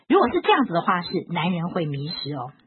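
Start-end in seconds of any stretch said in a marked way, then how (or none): none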